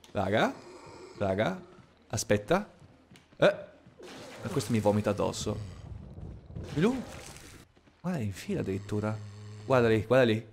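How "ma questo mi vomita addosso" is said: normal talking voice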